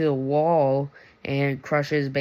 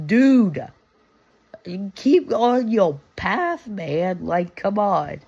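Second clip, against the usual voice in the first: higher, louder exasperated mock scold; annoyed Balaam; 5 semitones higher, 4 dB louder, slower than usual. Balaam scolding the donkey that ran into a field, higher exasperated mock scold. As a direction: nag the stubborn donkey, fed up. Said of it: "hits the donkey and is like".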